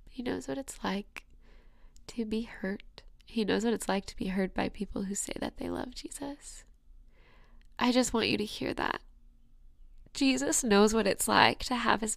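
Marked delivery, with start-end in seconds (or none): 0.1-6.6 soft, breathless whisper about being hurt; intimate whisper. Real, not acted. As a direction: whisper it like a secret wound.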